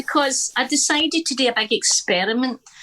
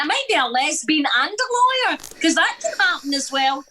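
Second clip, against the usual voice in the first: put-on child's voice; 6 semitones higher than usual. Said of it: "She went".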